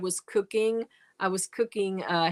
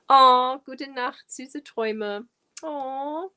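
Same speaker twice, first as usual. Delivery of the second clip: normal talking voice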